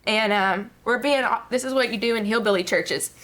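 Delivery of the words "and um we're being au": ordinary talk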